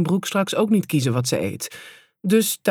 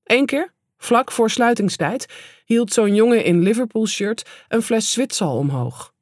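ordinary delivery, nothing marked

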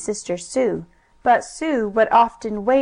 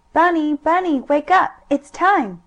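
put-on excited little girl; 6 semitones higher than usual. Sue waking her brother.

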